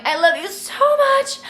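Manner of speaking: sweet high farewell; gushing sign-off. Real, not acted. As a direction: squeal it to the fans. Signing off to viewers.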